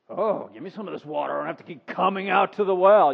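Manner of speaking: mock-eager, dripping sarcasm; sarcastic. Woman taunting Jesus at the well, sarcastic. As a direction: ask for it while not meaning a word. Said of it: "You can hear the sarcasm in her voice".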